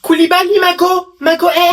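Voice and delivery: high, loud call across the street; yelling to a friend across the way. Acted. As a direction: shout his name to get his attention. Calling out to a friend.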